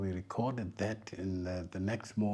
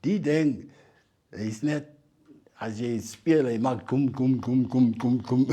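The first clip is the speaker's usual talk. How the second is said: higher and louder, imitating the old man; quoting the old San man, louder and higher; old man quoted in Afrikaans; 4 semitones higher, 11 dB louder than usual. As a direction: play the elder explaining the bow in Afrikaans, going gum gum. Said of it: "And he said".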